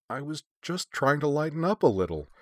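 high, helpless quaver; helpless, quavering self-defense; quavering protest. A narrator's reading. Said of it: "her voice quavering".

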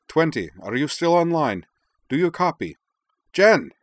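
rising to a high, loud cry of her name; urgent radio call building to a yell. Acted. Calling out to lost station.

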